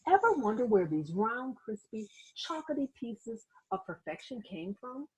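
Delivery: ordinary delivery, nothing marked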